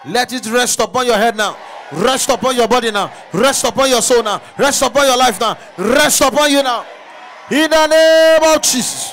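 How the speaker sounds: loud, high, shouted prophecy; full-force preaching. Acted. Pastor prophesying power over the congregation, shouting. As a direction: climb to a roaring climax.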